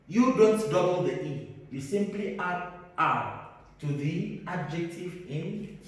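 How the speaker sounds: ordinary delivery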